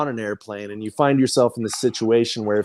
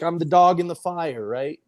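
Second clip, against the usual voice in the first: ordinary talk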